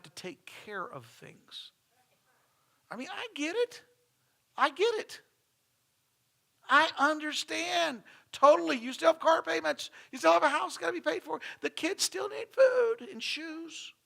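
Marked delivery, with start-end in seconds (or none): 2.9-13.9 much higher, pleading voice of a reluctant worker; whiny excuses. Pretend. Pastor voicing a congregant's excuses for not working unpaid, high and pleading. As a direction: whine out every excuse as a put-upon member.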